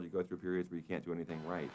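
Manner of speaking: ordinary delivery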